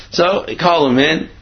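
ordinary delivery

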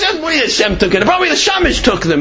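high, fast incredulous outburst; exasperated scolding. Acted. Rav scoffing that Hashem took the challahs, exasperated.

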